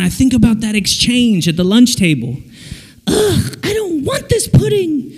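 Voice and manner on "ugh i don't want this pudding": very high squeaky whine; whining child. Acted. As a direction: refuse it like a fussy kid. Child whining at lunch table.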